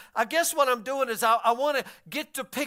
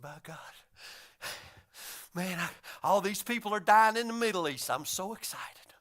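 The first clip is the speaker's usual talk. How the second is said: low, breathy thrill sinking to a whisper; mock hushed excitement; 4 semitones lower, 6 dB quieter than usual. Imitating a coworker excited about wars, hushed thrill. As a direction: marvel under your breath, barely containing it. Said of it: "he'd get excited".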